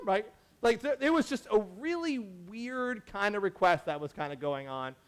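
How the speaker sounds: ordinary delivery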